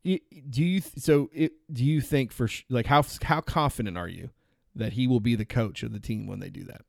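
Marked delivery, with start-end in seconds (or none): none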